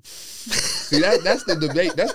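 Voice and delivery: laughing through the words. Real, not acted. S1 laughing at the grimy resale story, laughing. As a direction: chuckle it out, amused.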